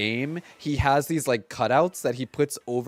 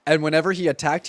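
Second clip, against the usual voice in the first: normal talking voice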